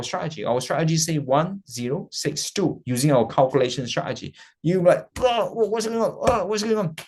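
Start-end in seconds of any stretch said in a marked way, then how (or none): 5.2-7.0 high-pitched bewildered wail; mock panic. Trader alarmed by a sudden move, mock panic. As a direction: play a flustered trader in alarm.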